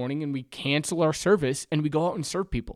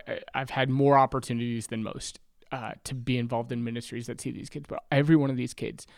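ordinary talk, nothing marked

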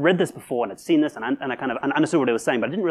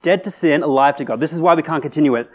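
normal talking voice

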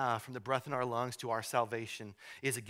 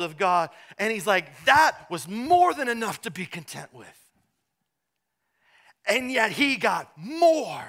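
loud, rousing pulpit delivery, much higher and louder; preaching at full force; 8 semitones higher, 12 dB louder than usual. Acted. Pastor to congregation on Paul's gratitude, impassioned preaching. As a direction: drive it home to the congregation.

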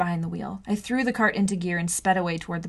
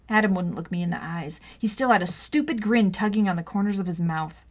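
usual speaking voice

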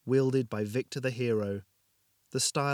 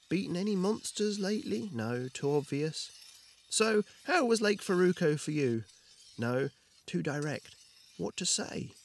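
flustered inner voice; 7 semitones higher than usual. Acted. Jorgin rehearsing questions to himself.

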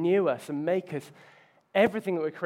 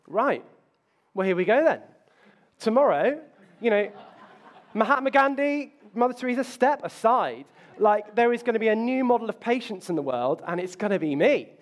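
mock grand self-confidence; 6 semitones higher, 6 dB louder than usual. Imagining himself a patience model.

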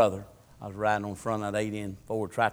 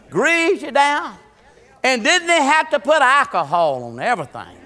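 raised, high, loud pulpit voice; full-force preaching; 13 semitones higher, 9 dB louder than usual. Real, not acted. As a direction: proclaim it loudly to the whole church.

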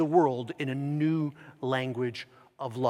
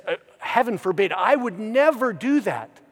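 quick, higher-pitched denial; mock protest; 5 semitones higher, 5 dB louder than usual. Imagined disciples deny they would betray Jesus, mock protest. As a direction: protest innocence in a hurry.

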